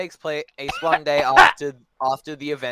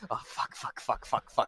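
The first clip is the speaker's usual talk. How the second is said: panicked whisper; 5 semitones lower, 9 dB quieter than usual. Pretend. Panicked hiding from cops.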